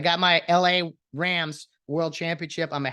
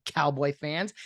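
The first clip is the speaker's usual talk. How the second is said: ordinary talk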